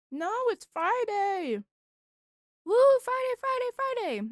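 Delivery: high, loud Friday whoop; excited cheer. Real, not acted.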